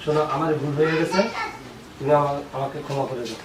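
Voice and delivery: soft, low pleading; subdued apology. Acted. Husband begging wife's forgiveness, hushed and contrite. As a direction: plead quietly, voice dropping away.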